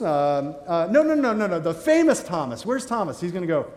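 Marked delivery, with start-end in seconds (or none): 0.9-3.2 high, loud string of refusals; insistent correction. Acted. Newcomers correcting the man at heaven's gate, insistent correction. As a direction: cut in loudly to correct a misunderstanding.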